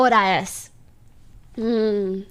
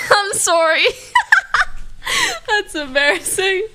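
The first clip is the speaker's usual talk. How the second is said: words breaking into giggles; embarrassed, still cracking up; real embarrassed laughter; 5 semitones higher than usual. Real, not acted. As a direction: apologize while laughing. Cracking up at own mistake.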